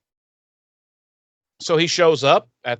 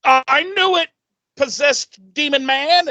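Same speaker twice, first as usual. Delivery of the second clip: mock triumphant accusation; 12 semitones higher, 4 dB louder than usual. Angel accusing the choking dad, hammed-up triumph. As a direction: shout it like you caught the demon. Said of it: "Angel's like".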